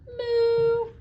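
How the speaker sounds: high loud drawn-out moo; long loud moo. Acted. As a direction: moo like a cow. Dog makes cow sound.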